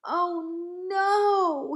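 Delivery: long, high, loud wail; dismayed cry. A narrator's reading. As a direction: cry out in horror, stretching the vowel. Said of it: "he cried".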